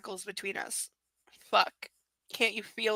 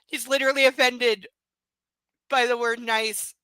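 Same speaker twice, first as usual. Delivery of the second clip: louder, mock-outraged outburst; incredulous outburst; 3 semitones higher, 9 dB louder than usual. Real, not acted. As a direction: blurt it out in disbelief.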